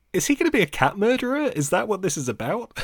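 high, fast mock-alarmed question; shocked question. Remembering his alarm about the butler, mock-shocked. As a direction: act out your childhood shock, quickly. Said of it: "I was like".

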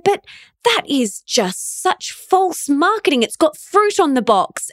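high-pitched, imitated naive indignation; innocent protest. Daughter mimicking her dad objecting to false marketing, innocently. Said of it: "He said it so innocently".

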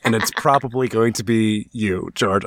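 low, mock menacing threat; mock menacing villain. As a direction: play the hammy villain. Mock threat to a rival fan.

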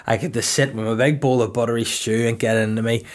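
ordinary talk

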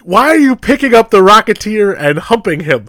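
loud, high incredulous question; mock bafflement. Viewer questioning the hunter's humping, mock incredulous. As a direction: demand it in mock disbelief.

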